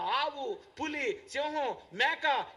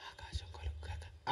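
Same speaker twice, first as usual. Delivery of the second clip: weak, whispered mock command; barely audible, unhurried murmur; soft and slow; 12 dB quieter than usual. Shown as a demonstration. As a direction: whisper it slowly, with no force at all. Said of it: "దేవుడేదో స్లోగా".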